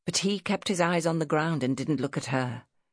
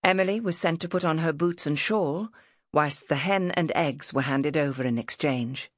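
ordinary delivery, nothing marked

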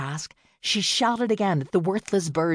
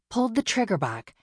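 ordinary delivery

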